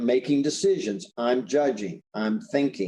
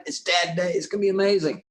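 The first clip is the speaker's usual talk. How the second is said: higher-pitched retold boyish excitement; excited little boy; 4 semitones higher, faster than usual. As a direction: lightly act out a kid's eager anticipation. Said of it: "He's really excited".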